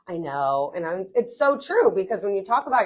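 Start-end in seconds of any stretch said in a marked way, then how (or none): 0.0-0.7 sighed, low admission; resigned sigh. Retold or imitated. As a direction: imitate a friend giving in with a sigh. Imitates friend's sighing, resigned reply.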